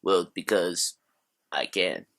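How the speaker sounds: mock French accent, lightly put on; weird French accent. Movie reviewer opening his video in a deliberately bad French accent. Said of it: "talking with a very weird French accent".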